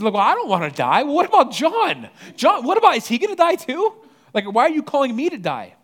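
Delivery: high, loud, whining protest with a laugh in it; whiny Peter. Retold or imitated. Peter complaining to Jesus about John, high mock whine. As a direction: whine like a kid pointing at his brother.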